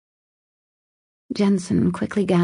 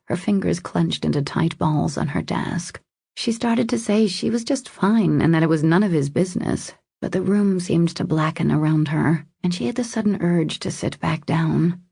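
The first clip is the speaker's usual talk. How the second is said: ordinary delivery, nothing marked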